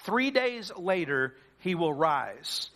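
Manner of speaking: slow and grave, falling to a low finish; slow solemn reading. Acted. Preacher reads Jesus' promise aloud, slow and solemn. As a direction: read it slowly like scripture.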